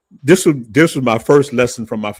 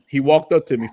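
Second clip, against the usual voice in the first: usual speaking voice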